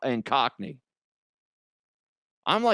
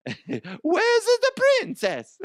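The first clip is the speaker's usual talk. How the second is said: slow, very high cartoon Italian squeal; hammed-up game character voice; Super Mario; 4 dB louder, much slower than usual. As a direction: drag it out in a falsetto Italian accent. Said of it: "it's fucking Mario from Mario Brothers".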